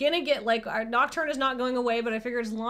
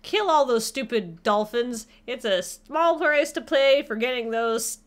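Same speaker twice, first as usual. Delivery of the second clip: mock commercial; 4 dB louder than usual. Streamer selling fake stain remover, mock commercial. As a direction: punch it like a parody ad read.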